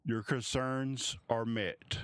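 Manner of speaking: slow, deliberate royal answer; measured reply. Acted. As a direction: lay each word down slowly. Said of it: "she looks straight across and goes".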